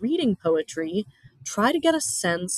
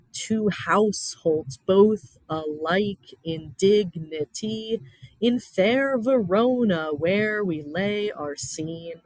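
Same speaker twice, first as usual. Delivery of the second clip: slow, every beat hammered in da-DUM rhythm; overemphasized meter; slower than usual. Shown as a demonstration. Teacher reading the Romeo and Juliet prologue, slow and beat-heavy. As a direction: read it like a metronome, leaning hard on each stressed syllable. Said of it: "I'm overemphasizing the meter".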